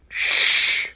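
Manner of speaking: sharp unvoiced hiss; hissed shush. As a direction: hiss for silence. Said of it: "hissed Mounshroud".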